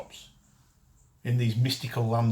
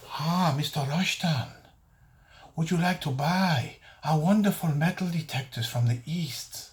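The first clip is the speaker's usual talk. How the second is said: mock Eastern accent; 4 semitones higher than usual. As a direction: put on an Eastern salesman's accent, pitched up. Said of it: "Quite a good accent".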